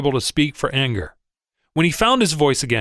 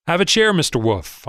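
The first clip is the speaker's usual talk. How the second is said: plain reading voice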